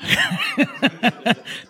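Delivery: short laugh at his own joke; light chuckle; amused laugh. Real, not acted.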